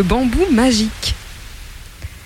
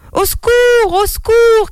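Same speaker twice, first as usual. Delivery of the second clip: cry for help. Acted. Mother whose son is stuck up high, crying for help. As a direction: scream for help as a frightened mother. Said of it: "cria la mère".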